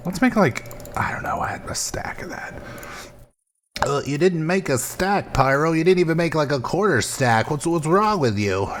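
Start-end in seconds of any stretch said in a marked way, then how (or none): 1.0-2.5 softer whispered aside. Real, not acted. Muttering aside while crafting. 3.9-8.7 higher scolding troll voice. Pretend. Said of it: "that's my new troll voice".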